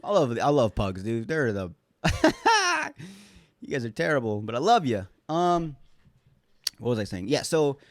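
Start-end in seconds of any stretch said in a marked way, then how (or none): 2.0-3.1 high, loud laugh breaking out; cracking up at the dogs; high burst of laughter. Real, not acted. Podcaster laughing at his misbehaving pugs, high and loud.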